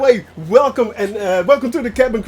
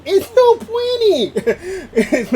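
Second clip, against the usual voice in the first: laughing; 8 semitones higher, much slower than usual. Real, not acted.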